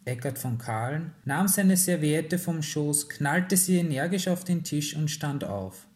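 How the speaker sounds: usual speaking voice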